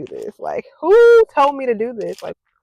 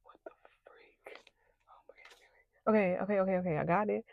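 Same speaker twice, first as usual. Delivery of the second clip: breathless frightened whisper; hushed scared whisper; 5 semitones lower, 17 dB quieter than usual. Real, not acted. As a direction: whisper to yourself in panic. Whispering to herself in panic.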